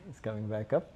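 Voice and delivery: soft, choked-up aside; emotional. Real, not acted. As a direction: speak softly as real feeling wells up. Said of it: "why am I getting emotional".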